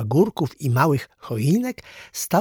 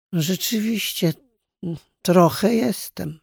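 sheepish admission; 7 semitones higher than usual. A narrator's reading. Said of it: "przyznał".

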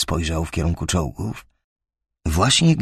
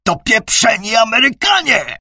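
high, loud desperate yell; shouting; 13 semitones higher, 5 dB louder than usual. A narrator's reading. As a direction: scream it into the mic. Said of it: "wrzasnął w mikrofon".

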